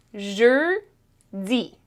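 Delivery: "Jeudi" split into two drawn-out syllables; syllable demonstration. Shown as a demonstration. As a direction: model "Jeudi" with a long closed eu, then "di". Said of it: "Jeudi has two syllables".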